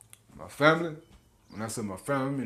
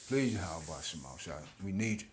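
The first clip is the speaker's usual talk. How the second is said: low, soft, slow prayer; quiet plea; 8 semitones lower, 10 dB quieter than usual. Real, not acted. Preacher breaks off to pray to Yahweh Shai, soft and slow. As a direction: beg softly, eyes closed, slowly. Sudden prayer mid-sermon.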